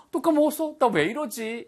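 teacher acting forgetful panic; flustered dismay. Shown as a demonstration. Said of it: "이런 식의 느낌인 거죠".

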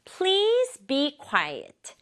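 drawn-out model phrase, word by word; slow demonstration. Shown as a demonstration. Teacher has pupils repeat the request, slowly. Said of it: "천천히 말해볼게요".

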